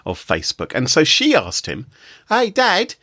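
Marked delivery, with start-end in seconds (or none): 2.3-2.9 put-on a light Boston accent, voice pitched up for the teenage daughter; mock Boston teen asking her dad, higher. Host voicing the teenage daughter asking her dad for cash, light Boston accent. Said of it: "Presumably she said it in a Boston accent".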